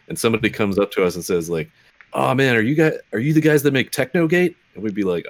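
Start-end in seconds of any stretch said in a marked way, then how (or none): 2.1-4.5 higher-pitched pretend fan; playfully awestruck, voice lifted; imagined excited fan. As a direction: act out a fan recognizing the podcasters. Voicing an imagined fan recognizing them.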